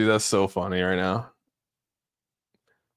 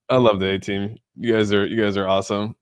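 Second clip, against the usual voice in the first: normal talking voice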